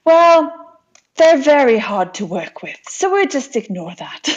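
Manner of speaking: mock Russian accent, tipping into amusement; booming Russian accent. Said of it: "booming voice and accent".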